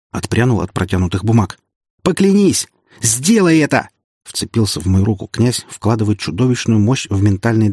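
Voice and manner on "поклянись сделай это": much higher, loud demanding shout; dying prince demands an oath, high and loud; commanding shout. Acted. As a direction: force the oath out of him.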